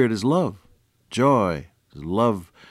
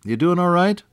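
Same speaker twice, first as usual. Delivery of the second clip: high, loud radio hello; big radio-host welcome; hearty greeting; 8 semitones higher, 5 dB louder than usual. Real, not acted. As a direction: call out heartily to the listeners. Radio greeting called out to listeners.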